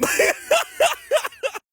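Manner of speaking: high burst of laughter; real laugh. Real, not acted. Storyteller amused by needing mediation, laughing high. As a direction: crack up at your own joke.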